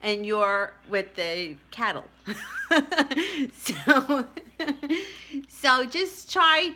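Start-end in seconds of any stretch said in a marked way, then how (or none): 2.3-3.5 light laugh. Real, not acted. Laughing at cattle joke.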